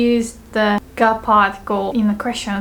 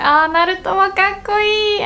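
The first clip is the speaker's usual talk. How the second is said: gushing fangirl; 10 semitones higher than usual. Shown as a demonstration. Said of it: "talking about hot anime boys".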